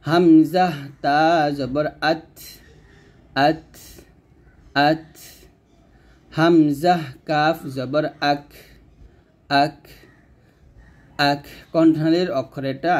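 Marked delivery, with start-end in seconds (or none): 0.1-9.8 slow spelling, "আত" and "আক" ending in a puff of air; hams, breath released. Shown as a demonstration. Teacher models hams for learners, "আত" and "আক" slow with breathy final consonant. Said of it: "বাতাসটা চালু রেখে উচ্চারণ করতে হবে". 11.3-11.4 "আক" cut short, then a puff of air on ক; hams, breath released. Shown as a demonstration. Teacher repeats for learners, "আক" with an aspirated final ক. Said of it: "বাতাসটা চালু রেখে উচ্চারণ করতে হবে".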